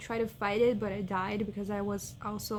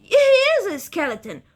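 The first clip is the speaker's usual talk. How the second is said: high, loud outraged cry; indignant shout; 9 semitones higher, 11 dB louder than usual. Real, not acted. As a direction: yell it in disbelief. Outraged at game bestiary.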